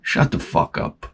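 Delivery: voiceless muttered curse; under his breath. Retold or imitated. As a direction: say it under your breath so no one hears. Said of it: "under his breath".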